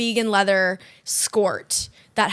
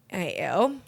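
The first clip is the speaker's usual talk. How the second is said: disgusted clerk; 4 semitones lower, 7 dB quieter than usual. Acted. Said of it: "they're literally like".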